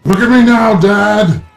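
high, loud yell of triumph; full-volume, high-pitched boast; triumphant shout. Pretend. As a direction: holler it proudly to a far-off father.

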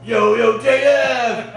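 loud, high holler; big shouted, acted hello; enthusiastic greeting. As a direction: hail a friend eagerly across the yard. Acting later eager greeting, contrasting reluctance.

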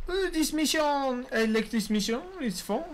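exaggerated French accent, "this" said as "dis". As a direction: ham up a French tourist gushing "Oh, this mission!". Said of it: "sound any bit, even a little bit French".

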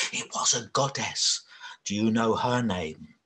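ordinary delivery

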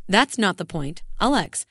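ordinary delivery

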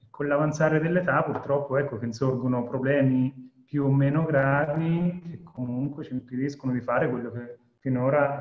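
ordinary delivery

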